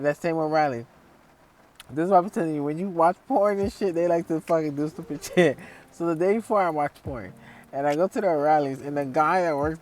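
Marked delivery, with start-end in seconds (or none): none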